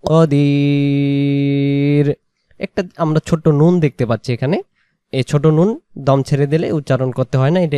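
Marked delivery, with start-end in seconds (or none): 0.0-2.1 কদির with a heavy short ক, not কা. Shown as a demonstration. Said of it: "কফ জবর ক". (কদির, কাদির নয়)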